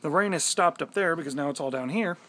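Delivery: ordinary talk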